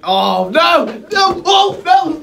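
yelling as he falls backwards; real startled cry, loud and high; real alarm. Real, not acted. Chair tips over mid-take.

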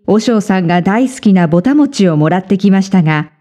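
usual speaking voice

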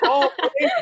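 high, loud hammed-up "oh, I get it"; mock sudden realisation. Man mimicking his ex finally understanding, exaggerated delight. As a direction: squeal the penny dropping, imitating her.

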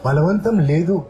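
ordinary talk, nothing marked

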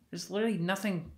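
ordinary delivery

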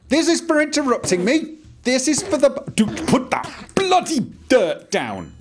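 exasperated high-pitched game rant; angry yelling. Pretend. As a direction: yell at a pest who interrupted you.

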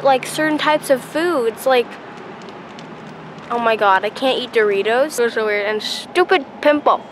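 metal braces on teeth; getting used to braces; talking with new braces. Real, not acted. Seen 1: Metal brackets visible on her teeth throughout; freshly fitted at the orthodontist.

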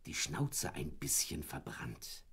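slowly whispering the dog's mishap. A narrator's reading. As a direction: hush it slowly like a confession. Dog remembers burnt snout.

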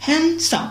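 "হ্যান্ডসাম" said "hen-sam", no d; silent d. Shown as a demonstration. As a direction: leave the d out of "হ্যান্ডসাম". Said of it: "এটা কিন্তু হ্যান্ডসাম হবে".

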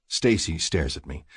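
plain reading voice

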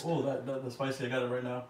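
talking through a mouthful; mouth full. Real, not acted. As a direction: say it while still chewing. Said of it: "Talking With Your Mouth is, uh, full".

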